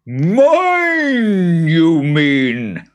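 very high, odd creature voice; squeaky-high boggart challenge. Acted. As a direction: sneer it as a high little boggart.

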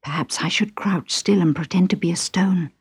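hushed unvoiced frog whisper. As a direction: whisper it, afraid to be heard. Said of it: "thought the little frog".

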